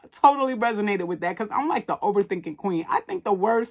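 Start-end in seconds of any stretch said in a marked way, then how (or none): none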